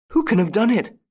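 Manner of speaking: a scared widow asking into the dark; anxious widow's question; frightened widow. A narrator's reading. Widow voiced in story.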